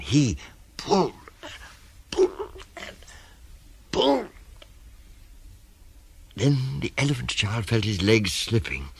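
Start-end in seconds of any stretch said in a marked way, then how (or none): 0.0-4.3 squeezed, very high effortful words; straining pulls. A narrator's reading. Narrator acts the crocodile tugging, strained and high. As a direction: heave each word out, higher and tighter.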